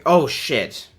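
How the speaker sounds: ordinary delivery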